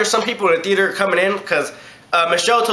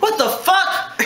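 high, outraged mock yell from the customer; mock angry yell; 11 semitones higher, much slower than usual. Customer caught with food, hammed-up outrage. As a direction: play the rude customer snapping back.

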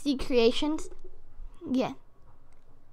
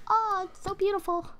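excited; 6 semitones higher, 7 dB quieter than usual. Real, not acted. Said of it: "Sorry, I'm getting excited".

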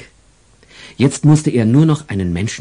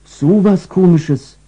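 amazed wonder; 9 semitones higher, 4 dB louder, slower than usual. Acted. Angel's childlike wonder.

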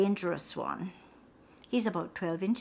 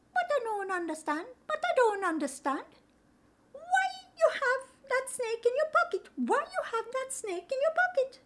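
much higher, sing-song greeting in island lilt; spider Anansi. Acted. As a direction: chirp the greeting, concerned. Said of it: "So Brer Anansi said".

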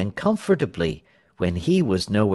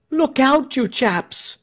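high, alarmed warning; frightened voice; 13 semitones higher, slower than usual. A narrator's reading. Onlooker warning crowd of danger, frightened. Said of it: "interrupted by a frightened voice".

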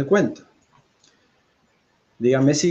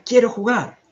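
high, emphatic cry; loud shout; 11 semitones higher, 7 dB louder than usual. A narrator's reading. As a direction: shout out the wish to play. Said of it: "y grita".

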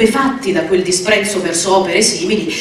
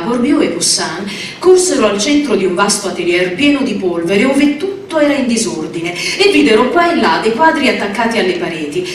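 ordinary delivery, nothing marked

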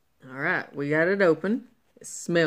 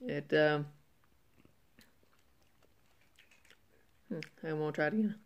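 talking while chewing; mouth full of wafer; 7 semitones higher, 7 dB quieter than usual. Real, not acted. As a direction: between bites of wafer. Seen 1: Bearded man holds bitten wafer, bites and chews it while talking.

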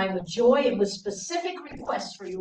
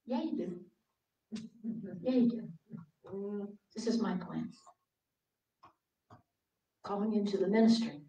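put-on God gently answering the crying teen; soft divine answer; 12 dB quieter than usual. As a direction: answer her calmly like God.

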